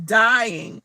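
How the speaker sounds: usual speaking voice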